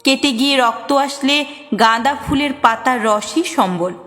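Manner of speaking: ordinary delivery, nothing marked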